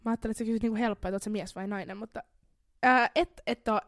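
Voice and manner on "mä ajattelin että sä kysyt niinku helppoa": usual speaking voice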